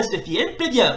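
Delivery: ordinary talk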